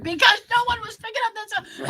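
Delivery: exasperated retort. Retold or imitated. Daughter to missing mother, exasperated shout. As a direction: snap back in exasperation.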